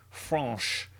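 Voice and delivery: French said the French way, FRAHNSH with a nasal vowel; mock French pronunciation of French; fake French accent. Shown as a demonstration. Teacher hams up a fake French accent for French. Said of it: "Sorry, my fake French accent".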